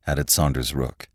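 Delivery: usual speaking voice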